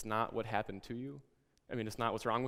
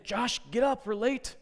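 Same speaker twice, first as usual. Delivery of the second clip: high, loud mock yell of a harried mom; 13 semitones higher, 8 dB louder than usual. Mom hurrying her son out of bed, loud high yell. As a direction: shout him out of bed, high and urgent.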